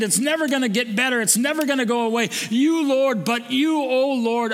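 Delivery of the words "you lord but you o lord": a raised, emphatic call to the Lord; fervent prayer. Acted. Preacher crying out to God in hardship, fervent. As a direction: build it like a cry for help. Prayer climax before soft close.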